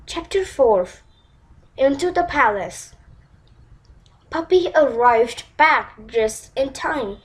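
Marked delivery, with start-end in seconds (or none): none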